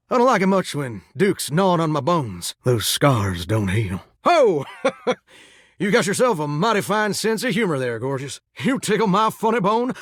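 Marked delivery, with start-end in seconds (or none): 4.2-5.1 hearty "ho" breaking into laughter; amused laugh. Acted. Tickled by the visitor's sense of humor, laughing.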